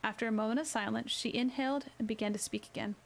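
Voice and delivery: ordinary delivery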